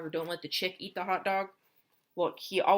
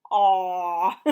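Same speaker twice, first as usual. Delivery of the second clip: plain reading voice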